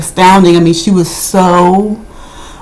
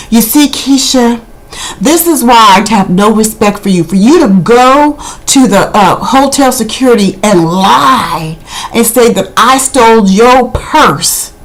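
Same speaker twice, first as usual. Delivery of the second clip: mock Tammy; 2 semitones higher than usual. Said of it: "she walks into the room and goes".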